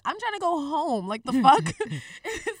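loud fed-up outburst; frustrated and loud; exasperated. Retold or imitated. Exasperated retelling.

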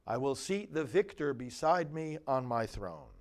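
steady, solemn refrain and verses; psalm recitation. Acted.

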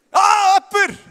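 high, loud cry of relief; delighted shout. Real, not acted. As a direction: shout it out, finally someone. A hand finally goes up.

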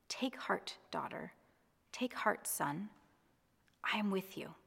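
soft, hushed and tender, fading to a whisper; gentle reassurance. Acted. Pastor voicing Jesus reassuring a frightened listener, hushed and tender. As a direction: comfort a frightened listener softly.

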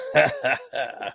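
a hammed-up villain laugh in a made-up accent. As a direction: laugh like a smug cartoon villain. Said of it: "laughing with an accent I just created".

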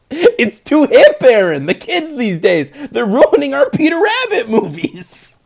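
high, loud comic complaint; hammed-up old-fashioned grievance; mock outrage. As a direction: wail in pretend indignation, laughter creeping in. Mock outrage at hip script.

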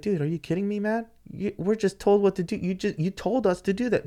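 high-pitched, aggrieved retort; indignant arguing. Retold or imitated. Driver answering official who talked trash, indignant. Acts out quarrel with track official.

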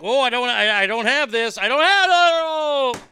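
imitating a performer slipping, hammed-up panic; panicked trapeze artist. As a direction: act out her losing grip. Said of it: "she's like".

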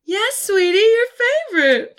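high, loud sing-song mom call; called sweetly. Acted. Mom calling sweetly to her son about the pie. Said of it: "she called sweetly".

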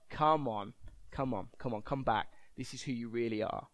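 low, soft, coaxing tempter; sin's tempting voice. Acted. Sin coaxing the preacher back to old habits, low. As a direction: coax quietly and insistently, pitched low. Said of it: "what sin says to me".

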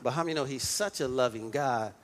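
usual speaking voice

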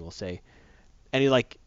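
normal talking voice

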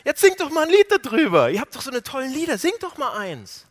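lauter, höher, spöttisch aufgedreht; höhnisches Veralbern. Retold or imitated. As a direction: zieh sie laut und höhnisch auf. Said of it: "Die haben die veralbert". (Eroberer verhöhnen die Gefangenen, laut spottend)

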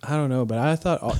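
ordinary delivery

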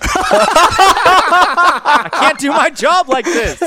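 high, very slow laughing wail; laughing protest. Acted. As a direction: whine it out through laughter. Rick protests sobriety, laughing.

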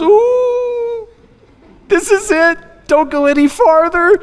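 very high, squeaky mock dread; alarmed child. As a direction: play a kid who knows trouble is coming. Child alarmed by mother's silent look.

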